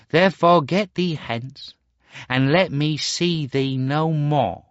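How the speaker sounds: bitter dismissal; hard and bitter. Acted. Star Child sends his mother away, hard and bitter. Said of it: "his voice was hard and bitter".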